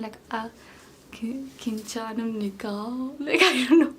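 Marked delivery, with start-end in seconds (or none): 1.1-2.7 softly attempted North Korean accent. Vlogger showing viewers how the drama characters talk, tentative North Korean accent. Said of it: "North Korean accent".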